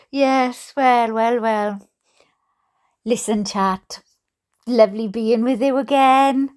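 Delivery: ordinary talk, nothing marked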